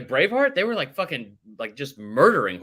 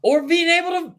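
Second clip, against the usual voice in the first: mocking jab; 7 dB louder than usual. Real, not acted. Teasing cohost's joke.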